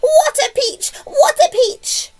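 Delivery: very high, loud, stretched whoop; exultant shout. A narrator's reading. Aunt hops round the tree in excitement, exultant shout. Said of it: "Aunt Spiker shouted".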